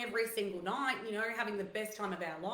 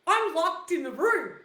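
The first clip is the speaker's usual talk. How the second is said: louder, a touch higher, spelling out the problem; indignant announcement; 5 semitones higher, 8 dB louder than usual. Retold or imitated. Girlfriend telling the boys on the phone, loud and indignant. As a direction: declare it loudly, fed up. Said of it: "I go".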